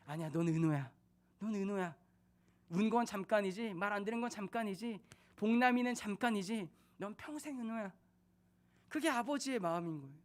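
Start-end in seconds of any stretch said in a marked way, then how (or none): 0.1-7.9 tender. Retold or imitated. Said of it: "아버지이기 때문에, 사랑하기 때문에".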